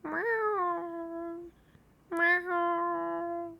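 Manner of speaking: high cat meow, mewed softly. Shown as a demonstration. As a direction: mew like a cat. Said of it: "I actually have a really good meow".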